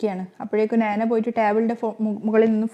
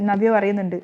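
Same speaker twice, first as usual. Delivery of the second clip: ordinary delivery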